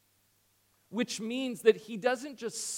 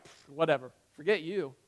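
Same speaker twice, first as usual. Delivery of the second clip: mock dismissive; 4 semitones lower than usual. Mock dismissive reply imagined for Jesus.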